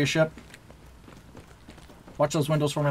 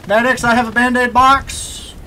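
shouting; 7 semitones higher, 6 dB louder than usual. Real, not acted. Calling for medics in battle.

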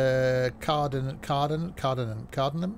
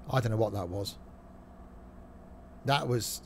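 ordinary delivery

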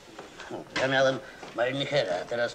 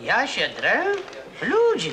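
high, loud, indignant denial; indignant outcry; 10 semitones higher, 4 dB louder, slower than usual. Acted. Husband accused of yelling at his wife, loud indignant outcry. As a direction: protest at the accusation, rising to a loud appeal.

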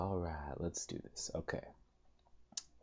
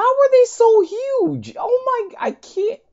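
shocked squeal; 7 dB louder than usual. Real, not acted. Gamer squeals at giant cells chasing her. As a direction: squeak it out, overwhelmed.

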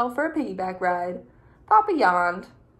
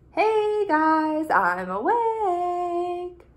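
higher, stretched-out excited call; eager wake-up call; 9 semitones higher, much slower than usual. Acted. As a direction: call out bright and drawn out to wake the house. Eager snail calls family at sunrise.

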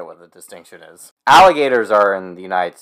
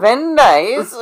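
high, bad French accent with a lifted ending; hammed-up French accent, pitched high; mock French accent; 13 semitones higher, 5 dB louder, slower than usual. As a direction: put on a silly French "very nice". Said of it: "That's French, right?".

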